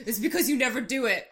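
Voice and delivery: lightly mimicked Irish accent; a hint of an Irish accent. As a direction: complain as the Irishman, mock-offended. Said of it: "Irish accent".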